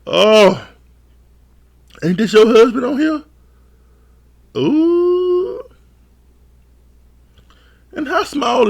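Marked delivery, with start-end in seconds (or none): 2.0-3.2 higher mock friend voice, a bit louder; friend asking. Friend asking about the husband, lightly higher voice. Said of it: "her friend told her". 4.6-5.6 mock ooh. Reacting to the gossip.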